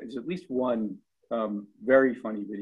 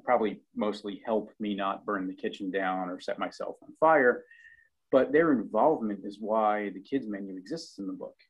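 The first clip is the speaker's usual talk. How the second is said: normal talking voice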